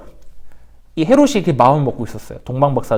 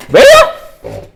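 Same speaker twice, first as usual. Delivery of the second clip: much higher, louder, squeaky-sounding false start; a misfire of the king's voice, pitched way up; wrong voice for Herod; 10 dB louder, much slower than usual. Shown as a demonstration. Teacher trying a Herod voice and missing it, much higher. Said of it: "내 목소리 이게 아니".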